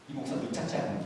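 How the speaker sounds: low, soft conspiratorial aside; hushed gossip. Acted. Church member nudging a friend about the pastor, imitated hushed gossip. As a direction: murmur it slyly to a friend.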